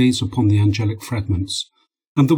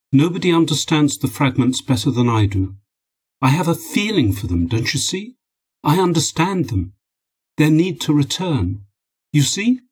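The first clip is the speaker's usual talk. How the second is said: higher-pitched, earnest appeal to be understood; insistent pleading; 5 semitones higher than usual. Acted. Scientist to his wife about the fragments, insistent pleading. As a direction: plead your case, pitch lifting into the questions.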